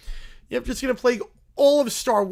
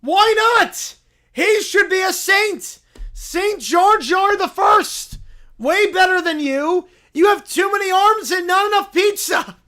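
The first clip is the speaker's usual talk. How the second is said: loud, indignant bear; indignant shouting; 10 semitones higher, 10 dB louder, slower than usual. Acted. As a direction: shout down the general. Bear argues for Jar Jar.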